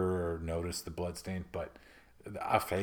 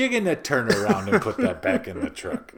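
lachend roepen; 9 semitones higher, 5 dB louder than usual. Acted. Acting out leaving haunted house.

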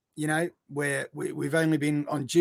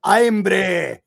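loud, hammed-up Scottish IRN-BRU; exaggerated Scottish; 3 semitones higher, 8 dB louder than usual. Shown as a demonstration. Showing you can't avoid it, loud exaggerated Scottish accent. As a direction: belt out IRN-BRU in broad mock Scottish. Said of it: "Like, you have to".

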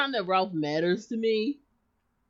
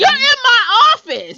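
loud and higher, slowed down; indignant shout; 13 semitones higher, 13 dB louder than usual. Acted. Jasmine yelling at her mother in her office, loud and high. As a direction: claim the room, raising your voice. Acted quarrel, playing Jasmine.

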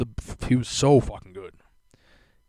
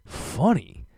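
higher, disbelieving echo; incredulous; 5 semitones higher, 3 dB louder than usual. Retold or imitated. Answering his girlfriend's joke, incredulous. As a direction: throw the word back in disbelief.

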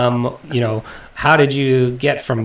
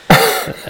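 breathless laugh; 3 dB louder than usual. Real, not acted. Laughs at end of story.

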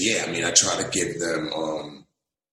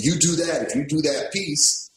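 lightly raised, earnest God voice; voicing God; 11 semitones higher than usual. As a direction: speak as God, a touch higher. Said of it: "God is saying".

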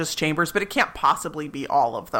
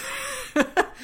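real laugh pitched way up; helpless giggle; high burst of laughter; 6 dB quieter than usual. Real, not acted. Speaker cracks up mid-story.

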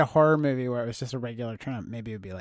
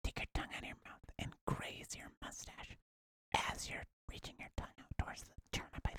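whisper; 20 dB quieter than usual. Pretend. Whispered dare game.

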